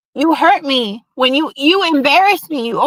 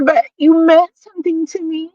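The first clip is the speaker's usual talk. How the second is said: ordinary talk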